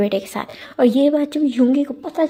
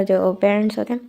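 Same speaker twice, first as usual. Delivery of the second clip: ordinary delivery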